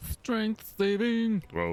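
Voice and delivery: slow, high announcer call; drawn-out announcement. Acted. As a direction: stretch it out like an announcer. DM announces the save dramatically.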